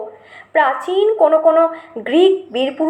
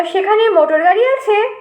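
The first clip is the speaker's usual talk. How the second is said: put-on child's eager question; 7 semitones higher than usual. A narrator's reading. Boy asks eagerly.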